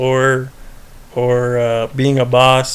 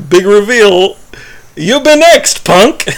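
a mock goofy boss voice, pitched up; goofy Michael; 6 semitones higher, 5 dB louder, slower than usual. Boss making it goofy to stop employee crying, pitched-up mock voice. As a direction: ham it up to stop her crying. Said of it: "tries to make it goofy".

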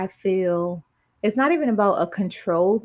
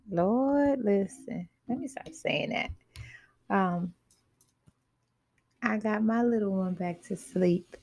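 hushed, half-whispered; whispering; 6 dB quieter than usual. Real, not acted. Baby just put back to sleep.

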